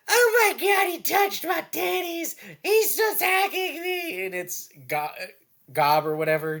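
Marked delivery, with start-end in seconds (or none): 0.1-4.3 high, slow, mock shrieking accusation; hysterical woman. Otherworlder woman accusing the goblin, high mock hysteria. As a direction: squeal it like an outraged girl. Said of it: "the woman's like".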